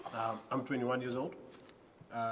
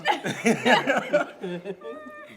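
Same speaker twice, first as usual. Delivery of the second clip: loud laugh; 14 semitones higher, 13 dB louder than usual. Real, not acted. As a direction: burst of high laughter. Laughing at hot sauce.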